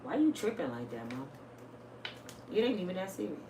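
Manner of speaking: cool him down like it's nothing; calm son. Retold or imitated. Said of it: "He always keep the same tone voice".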